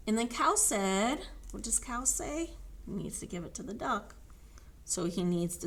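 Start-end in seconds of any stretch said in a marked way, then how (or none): none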